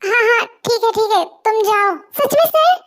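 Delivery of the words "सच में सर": leaps high with joy; thrilled disbelief, pitched up; delighted. Acted. Assistant to boss, overjoyed at being let go.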